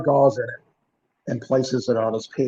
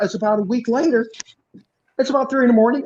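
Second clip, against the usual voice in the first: ordinary delivery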